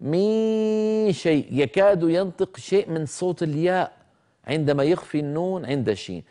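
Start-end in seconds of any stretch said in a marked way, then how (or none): 0.0-1.5 مي شيء with the نون turned into a ي-like sound, pitched higher and slow; mock the mistaken مي before شيء; imitating a wrong reading. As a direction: imitate students who say مي instead of a hidden nasal. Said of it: "أسمعهم يقولون مي".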